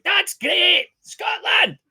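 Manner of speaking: high, loud mock-Scottish cheer; whooping put-on Scottish accent. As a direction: holler it like a rowdy fake Scot. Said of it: "talking is a Scottish accent all day".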